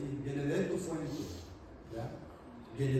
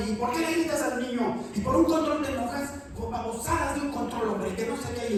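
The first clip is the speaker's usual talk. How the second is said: high, loud scolding wife; 8 semitones higher, 4 dB louder than usual. Retold or imitated. Wife scolding husband for yelling at child, high and loud. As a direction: nag him like an exasperated wife. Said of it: "y la esposa".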